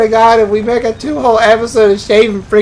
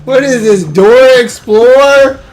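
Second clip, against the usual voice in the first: exasperated yell; 4 semitones higher, 6 dB louder, slower than usual. Real, not acted. Frustrated by endless doors.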